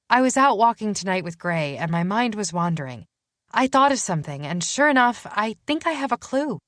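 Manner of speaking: bubbling over with her discovery; detective eagerly sharing a clue with Matthew; excited Danny. Acted. Danny excitedly explaining her idea.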